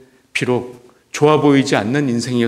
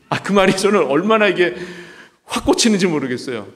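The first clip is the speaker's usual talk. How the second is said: ordinary talk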